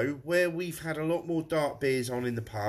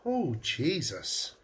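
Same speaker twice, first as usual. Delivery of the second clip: slow, drawn-out amazement at the taste; real astonished gasp of words; stunned surprise; 4 semitones lower, slower than usual. Real, not acted. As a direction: let the taste floor you, slowly. Tasting a strong stout.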